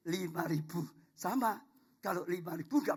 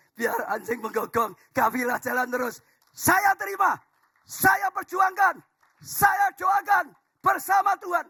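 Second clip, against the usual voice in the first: defiant preaching shout; 11 semitones higher, 10 dB louder than usual. Acted. Pastor to the congregation, answering the mockers with a defiant shout. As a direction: roar it back at the scoffers.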